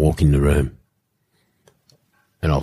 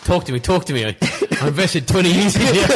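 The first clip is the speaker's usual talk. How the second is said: imitating teammate pleading with legs; 13 semitones higher than usual. Roommate talking to his own legs, pleading. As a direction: plead in a high voice, breaking into laughter. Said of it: "Talking to his legs".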